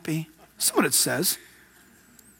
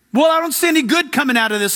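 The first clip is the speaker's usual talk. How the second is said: quick mock complaint; 6 semitones higher, faster than usual.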